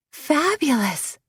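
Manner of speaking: high, slow and drawn-out delight; a rapturous, high "Fabulous"; gushing praise. Acted. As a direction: savour the word like a gift. Missy gushing over the makeover.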